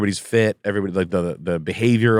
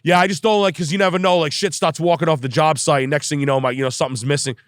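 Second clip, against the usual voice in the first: plumber voice; 6 semitones higher than usual. Retold or imitated. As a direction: raise the pitch, accuse in passing. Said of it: "I'm the fucking plumber".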